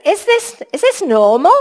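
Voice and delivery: high rising incredulous question; wondering aloud. Retold or imitated. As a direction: marvel at the news. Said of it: "he probably thought".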